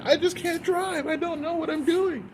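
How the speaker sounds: high, slow whiny mock driver voice; mocking helpless driver. Mocking Houston drivers as helpless, high whiny voice. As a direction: mimic a clueless driver, way up high and drawn out. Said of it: "they still be like".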